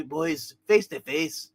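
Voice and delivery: ordinary delivery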